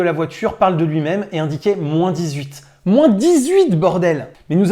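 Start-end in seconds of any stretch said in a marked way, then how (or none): 2.8-4.3 excited outburst. Real, not acted. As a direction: blurt it out, amazed at the cold. Exclaiming at the cold.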